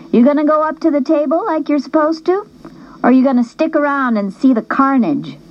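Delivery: high, lightly voiced girl teasing; teasing best friend. Darla ribbing the narrator after the auction, light high teasing.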